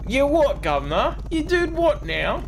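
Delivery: high-pitched mock British "governor" voice; mock British accent. Teasing a friend who sounds British, mock British accent.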